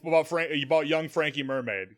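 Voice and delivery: ordinary talk, nothing marked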